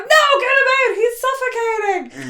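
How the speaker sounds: very high, loud hammed-up plea; mock panicked cry. Host imitating a mother begging for the baby's rescue, high mock panic. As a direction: cry it out in acted desperation.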